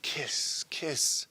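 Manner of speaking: soft, breathed-out word; hushed kiss. Acted. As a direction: let it out almost as a whisper. Kisses punctuating a love scene.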